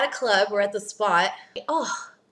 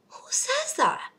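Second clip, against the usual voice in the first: high, slow disbelieving squeal; a high, drawn-out who says that; high and slow in shocked disbelief; incredulous; 13 semitones higher, slower than usual. Real, not acted. Outraged at crude pickup line.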